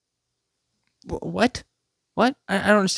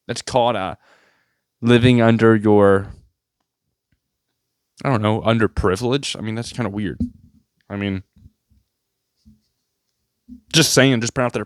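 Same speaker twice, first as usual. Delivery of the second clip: ordinary talk, nothing marked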